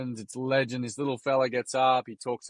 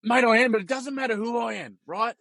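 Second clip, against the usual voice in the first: much higher voice, imitating the player; insistent retold reply; 9 semitones higher than usual. Player answering starstruck kid, high and insistent. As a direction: push it high, brushing off the fame.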